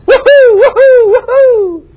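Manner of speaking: very high, loud mock whoops; sarcastic party whoops; whooping cheer.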